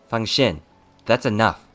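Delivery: firm intervention. Acted. Master stopping his two attendants' quarrel, loud and firm. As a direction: cut the quarrel off forcefully.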